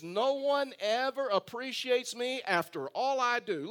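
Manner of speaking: slow, high-pitched self-pitying complaint; Moses. Retold or imitated. Preacher quoting Moses' favorite phrase, as a put-upon martyr. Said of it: "Moses was like a martyr".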